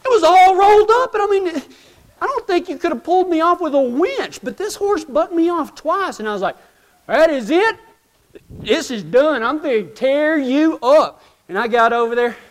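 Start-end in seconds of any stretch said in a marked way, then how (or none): 0.0-6.2 high-pitched outraged telling; storyteller getting worked up about the horse. Retold or imitated. Disbelief at being thrown. 7.1-11.2 mock fed up. Threatening the horse.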